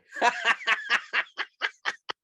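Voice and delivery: high laugh at himself; self-mocking laugh. Real, not acted. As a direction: laugh off your own soppy toast. Laughs at his own soppiness.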